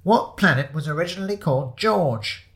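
high announcer reading a quiz question; quizmaster's voice. Acted. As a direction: put it to the room, high-pitched. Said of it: "asked the voice".

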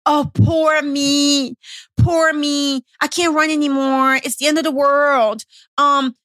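hammed-up "poor me" whining; whiny victim mode. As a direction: wail it like a mock victim. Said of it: "that victim mode".

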